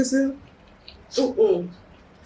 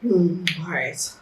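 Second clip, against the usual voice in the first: ordinary talk, nothing marked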